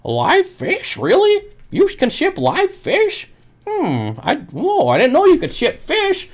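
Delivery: mock the customer's wide-eyed disbelief. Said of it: "That's what they say every time".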